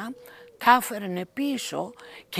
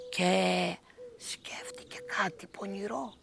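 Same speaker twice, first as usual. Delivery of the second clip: usual speaking voice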